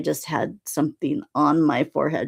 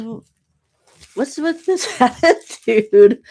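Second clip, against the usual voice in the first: amused scolding; 7 semitones higher, 6 dB louder, slower than usual. Retold or imitated. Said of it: "And he goes".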